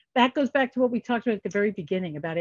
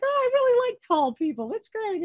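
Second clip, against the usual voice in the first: much higher, bubbly retold flirting; gushing flirt; 11 semitones higher than usual.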